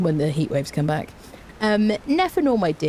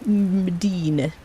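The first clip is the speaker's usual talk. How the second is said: slow stretched name; much slower than usual. Shown as a demonstration. Greeting viewer Medina, slow stretched sing-song. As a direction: draw the name out slowly in a strange voice.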